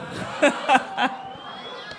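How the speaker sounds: long airy laugh. Real, not acted. Judge amused by the duo's new nickname, long laugh.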